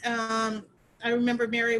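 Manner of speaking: normal talking voice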